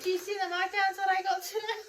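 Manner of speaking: high, over-eager crazy lady showing off her bargains. Pretend.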